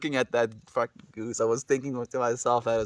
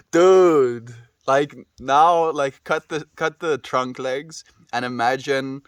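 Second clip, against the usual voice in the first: loud disbelief; 8 dB louder, slower than usual. Real, not acted. Shocked by imagined goose creature.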